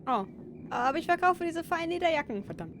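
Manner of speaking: high fast mock German. Joke line in German.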